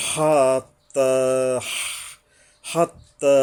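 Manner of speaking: হাত্তা, heavy throat হ; throaty ha. Shown as a demonstration. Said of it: "লম্বা হা ... গলায়".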